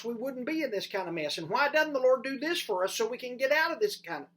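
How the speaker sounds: loud, high complaining protest; whining Gideon. Acted. Gideon protesting to the angel with a loud whiny complaint. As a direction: complain loudly like a frustrated man. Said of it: "that's what he does".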